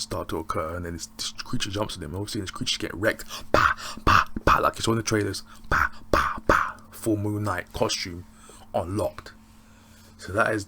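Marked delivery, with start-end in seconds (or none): 3.5-4.6 loud, percussive "bah" hits; imitated punches. Reviewer mimicking the creature getting wrecked, explosive punch noises. 5.7-6.8 loud, percussive "bah" hits; imitated punches. Reviewer mimicking the creature getting wrecked, explosive punch noises.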